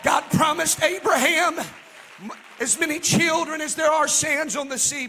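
loud, rising preacher's cadence; full-force preaching. Acted. Pastor rousing the congregation at Eastgate, full-force preaching. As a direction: preach it out, building to a shout.

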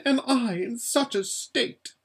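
high, fluttering voice of a pampered, scared lady; frightened Lasaraleen. Acted. Lasaraleen begs Aravis to feel her shaking, high and scared.

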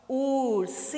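URSINHO slowly blended from long syllables. Shown as a demonstration. Teacher reading out the formed word, URSINHO syllable by syllable. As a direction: stretch out each syllable of URSINHO. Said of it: "que palavra que é essa?".

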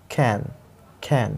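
can with a sound between k and kh; teacher's can. Shown as a demonstration. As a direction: say can as a soft aspirated khen. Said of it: "इसको आपको बोलना है can".

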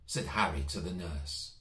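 plain reading voice

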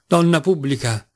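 ordinary delivery, nothing marked

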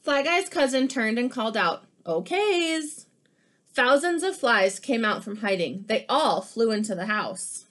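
lively picture-book read-aloud; animated storybook reading; reading to children. Acted. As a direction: read it like story time for kids.

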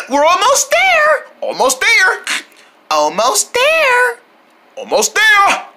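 high, drawn-out effortful calls; straining encouragement. Pretend. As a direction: stretch the words while carrying a load. Group straining near the crate.

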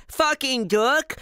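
put-on high, slow babyish babble; high-pitched dopey baby talk; baby idiot voice. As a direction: parrot it like a dim baby. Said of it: "and the baby".

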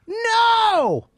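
very high, drawn-out "no"; different kind of no. Shown as a demonstration. Host showing a guest there are different no's, high drawn-out no. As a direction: stretch "no" out high in pitch. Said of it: "now there's different no's".

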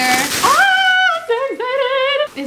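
high, slow, stretched squeal; thrilled squeal over a jacket; excited squeal. Real, not acted. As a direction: gush with real delight.

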